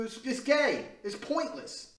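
ordinary delivery

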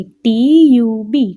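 letters spelt slowly and firmly; slow, emphatic letter-by-letter spelling. Shown as a demonstration. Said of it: "એમાં પણ ત્રણ spelling છે".

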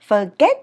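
"Forget" loud, "get" shooting up; Vietnamese rising tone. Shown as a demonstration. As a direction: send "get" flying up at the end. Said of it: "bay cái déo lên trên". (đọc "Forget" bay vút lên)

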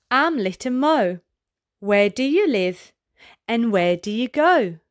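usual speaking voice

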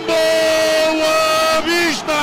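high, loud goal scream; fast dramatic football commentary. Real, not acted. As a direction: roar the goal call, stretching it. Second goal, commentator erupts.

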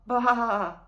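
loud crying baa; crying bleat. A narrator's reading. Little lamb crying out near the hidden wolf. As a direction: cry out like a frightened lamb.

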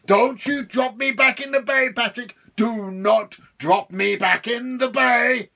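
high, loud, slow devil shout; devil yelling. Acted. Devil shouting at Patrick not to drop him. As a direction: roar the threat.

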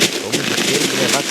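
loud call to a pig. Real, not acted. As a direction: shout across to the pig. Calling the pig in game.

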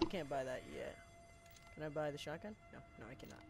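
ordinary delivery

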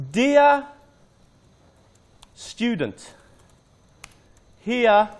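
word by word with long gaps; typing aloud. Shown as a demonstration. Teacher typing email to student, halting word by word. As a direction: spell out the email aloud as you type.

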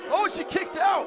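high, loud surprised call; surprised shout. Real, not acted. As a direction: gasp it out in disbelief. Surprise kickout called.

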